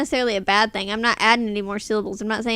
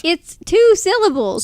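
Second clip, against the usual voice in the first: higher, emphatic protest; insistent; 8 semitones higher, 4 dB louder than usual. Real, not acted. Co-host defending her pronunciation, higher and insistent. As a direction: insist on each word. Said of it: "really point out the two syllables".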